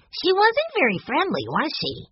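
put-on very high, piping child voice; little boy. A narrator's reading. Boy remarking to siblings outside, childlike. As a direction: voice it as a small, puzzled boy.

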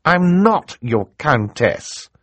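high, slow, annoyed retort; irritated girl leaning on "not"; testy. Acted. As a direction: correct him in extreme annoyance. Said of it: "Violet said testily, a word which here means".

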